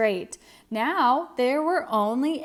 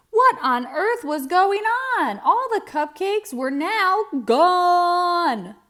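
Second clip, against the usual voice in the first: higher, louder, slowing on gone; astonished; 7 semitones higher, 4 dB louder than usual. A narrator's reading. Narrator reaching the climax, astonished and slow. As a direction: marvel at the empty plate.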